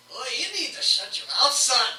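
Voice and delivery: high, loud tough-guy taunt; tough gnome. Acted. Gnome turning on the fighter, loud threat. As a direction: shut him down like a street tough. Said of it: "is like".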